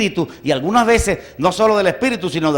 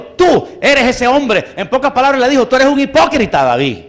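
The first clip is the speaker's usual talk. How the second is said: higher, louder stern denunciation; prophet's accusation; 7 semitones higher, 5 dB louder than usual. Retold or imitated. Nathan confronts King David, forceful accusation.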